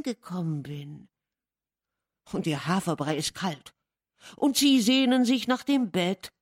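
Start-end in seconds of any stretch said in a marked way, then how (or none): 4.4-6.3 flustered apology. Acted. Housekeeper apologising to guest.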